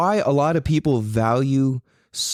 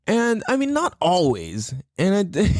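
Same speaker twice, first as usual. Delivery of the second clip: usual speaking voice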